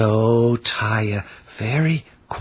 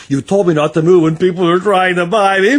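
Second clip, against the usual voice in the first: high, louder, fast and laughing; laughing accusation; 10 semitones higher, 6 dB louder, faster than usual. A narrator's reading. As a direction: tease him through laughter. Child scolds father, laughing.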